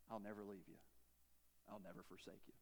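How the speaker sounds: softly promise, almost hushed; a gentle hushed reading of God's vow; tender hush. Acted. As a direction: give the promise quietly, tender and low.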